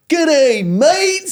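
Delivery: high, drawn-out mock hollered hello; called-out Aussie greeting. Imitating two arrivals greeting the villa, high holler. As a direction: holler it like an exaggerated Australian entrance. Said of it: "you hear Jesse and Aaron go".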